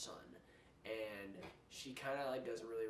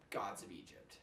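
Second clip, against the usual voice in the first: whispered title; 5 semitones lower, 5 dB quieter than usual. Acted. Announcing the next bad movie.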